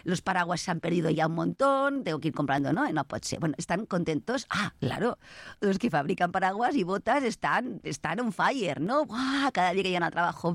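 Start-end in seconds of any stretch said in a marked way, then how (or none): none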